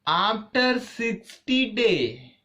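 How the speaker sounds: high, slow, drawn-out "After sixty day"; slow build-up. Shown as a demonstration. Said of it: "storyteller builds suspense".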